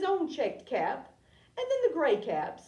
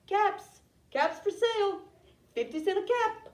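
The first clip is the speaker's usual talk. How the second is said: higher, carrying vendor's cry; street-seller's call; 6 semitones higher, 3 dB louder than usual. A narrator's reading. Peddler walking back to town selling caps, calling out. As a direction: call out your wares to the town. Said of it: "he walked back to town calling".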